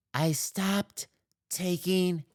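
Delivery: lightly voiced film character; movie quote voice. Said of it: "that's from a movie".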